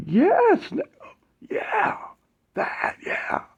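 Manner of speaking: very high, cracking, fading to a whisper; strained, breaking yes. Retold or imitated. Matt answering yes, voice cracking into a whisper. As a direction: voice breaks and falls away to a whisper.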